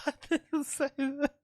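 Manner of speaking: high, slow mocking voice; parroting in a squeaky high voice; high mock sing-song. Mocking replay of the accusation.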